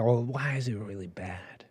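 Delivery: a quiet what's-the-harm voice; mock questioning. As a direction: drop to a quiet skeptical ask. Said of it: "be like".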